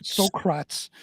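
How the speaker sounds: "Socrates" said as so-KRATS; "Socrates" ending like rats, no final EEZ; echoed mispronunciation. Retold or imitated. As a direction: echo "Socrates" in two syllables, so-crats. Echoing the mispronounced name.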